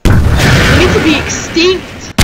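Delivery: loud, drawn-out angry yell; frustrated shouting. Real, not acted. Player furious that the monsters won't die, loud ranting yell. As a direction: rant at the unkillable things.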